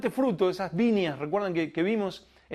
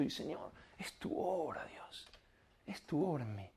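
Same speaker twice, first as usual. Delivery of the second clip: hushed, breathy whisper to God; a humble whisper of surrender; whispered prayer; 4 semitones lower, 15 dB quieter than usual. Acted. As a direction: whisper it as if lying prostrate. Prostrate prayer like Ana.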